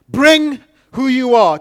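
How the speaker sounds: loud, slow, high preached line; preaching. Acted. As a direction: proclaim it to the congregation. Preaching point at full force.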